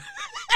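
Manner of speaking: sudden high-pitched laugh; high burst of laughter. Real, not acted. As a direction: cackle high at the irony. Laughing at the quoted advice.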